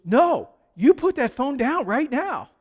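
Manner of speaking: insist, no arguing this time; insistent hostess. Retold or imitated. Hostess to pastor refusing to wait, insistent order. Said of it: "And then she goes".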